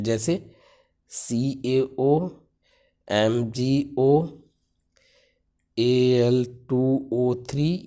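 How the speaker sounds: each formula spaced out with long pauses; slow, measured dictation pace. Shown as a demonstration. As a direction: spell it out for students to copy. Teacher dictating examples slowly.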